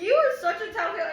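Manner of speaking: loud, high teasing through laughs; laughing teasing scold. Retold or imitated. Aunt teasing nephew, laughing loud and high. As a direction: tease the little tattler. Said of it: "I was like".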